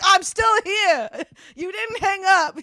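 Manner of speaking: high, drawn-out comic yell; playful indignant holler; exasperated mock shout. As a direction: call out in slow, hammed-up exasperation. Acting [name] yelling at callers.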